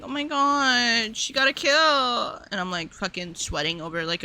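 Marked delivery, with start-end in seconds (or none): 0.0-2.4 drawn-out fake amazement, slow; mock slow gushing. Mocking the guys praising her kill, slow and drawn-out. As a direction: stretch it out like a fawning fan.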